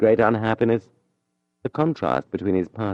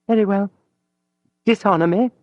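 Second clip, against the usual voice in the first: much higher, giving in; resigned sigh; 12 semitones higher, 4 dB louder than usual. Acted. Mathilde yielding to Julien with a sigh. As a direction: surrender with a weary breath. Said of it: "she said at length with a sigh".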